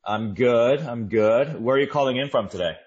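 ordinary talk

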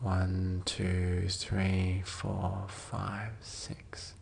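very soft, drawn-out count, fading away; hushed breath count. Real, not acted. Counting six as the listener lets go, hushed and fading. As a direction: count the exhale down into a whisper.

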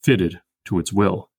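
slow, "will" stressed; FIT-ted to its WILL; trochee anapest reading. Shown as a demonstration. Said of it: "Or trochee anapest".

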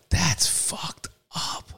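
very slow whispered aside. Real, not acted. Reacting to the prison sentence, a slow whisper. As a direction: breathe it out under your breath.

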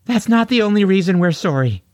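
tearful mother, high and quick; high-pitched and raspy. A narrator's reading. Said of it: "also speaking with a high-pitched and raspy voice".

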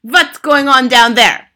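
high, loud imperious demand; shouting. A narrator's reading. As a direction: shout down at them as their leader. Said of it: "shouted the Grand High Witch".

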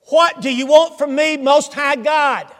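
high, loud and slow, crying out to God. Acted. Possessed man cries out to Jesus, loud and high. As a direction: hurl the question up at him, loud. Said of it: "with a loud voice he says".

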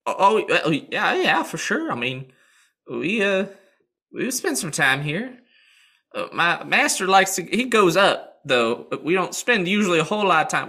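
a chirpy horse brushing off worry; high-voiced horse. Horse explaining his master.

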